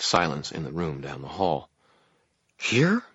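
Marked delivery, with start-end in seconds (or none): 2.6-3.0 high, alarmed rising question; panicky. A narrator's reading. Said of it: "echoed in a panicky tone".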